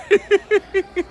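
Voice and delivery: quick high laugh; real amused chuckle, high-pitched. Real, not acted. A vlogger laughing at his comic-book excuse, quick high laugh.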